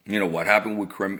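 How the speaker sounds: ordinary talk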